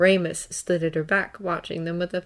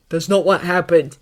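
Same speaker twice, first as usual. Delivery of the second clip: louder, urgent denial; called protest; 8 dB louder than usual. A narrator's reading. Harry defending himself to Draco, called-out protest. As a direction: raise the voice to protest. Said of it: "Harry called".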